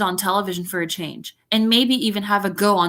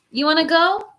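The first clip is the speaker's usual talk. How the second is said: pet talk; 7 semitones higher than usual. Real, not acted. Talking to pet Lulu.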